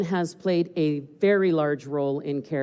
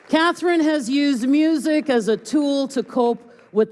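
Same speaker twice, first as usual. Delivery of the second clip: excited; 9 semitones higher, 6 dB louder than usual. Real, not acted. Host enthusiastic after total reveal.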